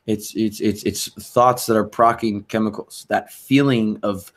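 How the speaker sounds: usual speaking voice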